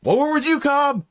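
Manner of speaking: high, hearty backing; rally behind Cobb; eager ranger. Acted. Ranger promising to back Cobb, high eager. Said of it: "And he's like".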